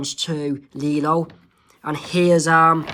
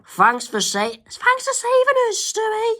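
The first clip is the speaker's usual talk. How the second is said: put-on very high squeaky girl voice. Isabella thanking Stewie for the rescue, squeaky high.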